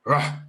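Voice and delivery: sharp loud rah; short sharp bark. Shown as a demonstration. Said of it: "a short, sharp".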